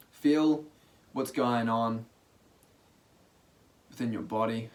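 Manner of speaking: soft, relaxed meditation guiding with long pauses; guided meditation. Real, not acted. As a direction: ease listeners into stillness, quietly and unhurriedly.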